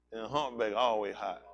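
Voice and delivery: ordinary talk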